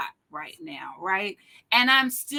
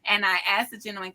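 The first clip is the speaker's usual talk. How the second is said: usual speaking voice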